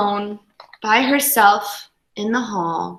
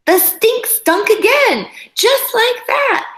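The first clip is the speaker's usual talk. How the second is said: high, louder dramatic read; dramatic storytelling; 13 semitones higher, 3 dB louder than usual. A narrator's reading. Dramatic high reading of stink.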